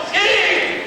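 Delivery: high yelled warning; mock yell. Man on the slope yells a warning, high mock yell. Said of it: "you hear someone yell".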